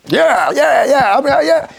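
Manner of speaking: high, rapid-fire repeated yeahs; hammed-up eagerness; eager show-off. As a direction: act keen for the watching camera. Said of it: "You'll be like".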